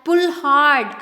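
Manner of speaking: loud shout; the snake shouting to the elephant child. A narrator's reading. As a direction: yell the warning across the river. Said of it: "shouted to the elephant".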